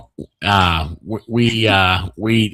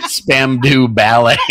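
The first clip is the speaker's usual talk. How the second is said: mock cultured English; 4 dB louder, much slower than usual. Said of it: "feel like I know something about English".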